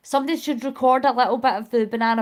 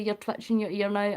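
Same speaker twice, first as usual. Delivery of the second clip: ordinary talk, nothing marked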